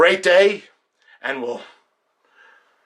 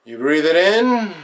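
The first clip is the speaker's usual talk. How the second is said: breathing-in cue; 3 semitones higher than usual. Acted. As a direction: lead the inhale on a long "in". Cueing an inhale in meditation.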